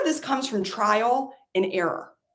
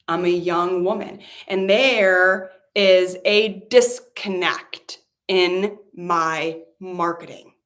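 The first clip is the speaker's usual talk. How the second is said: normal talking voice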